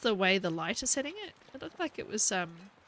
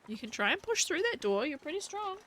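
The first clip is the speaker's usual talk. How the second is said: teasing pet-talk; 7 semitones higher than usual. Real, not acted. Teasing her dog at door.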